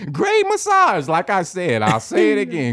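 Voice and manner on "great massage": high, slow sarcastic great massage; mock-rave about the massage; ironic praise.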